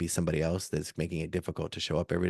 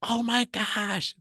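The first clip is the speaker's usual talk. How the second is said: very high, slow, drawn-out "Oh my gosh"; shocked gasp; much slower than usual. Retold or imitated. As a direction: stretch it out like stunned fans. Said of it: "People were like".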